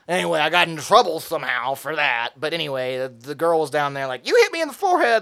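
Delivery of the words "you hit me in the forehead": high, whiny accusing voice; mock girl's accusation. Girl accusing the teen, high and whiny. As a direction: play the girl blaming him.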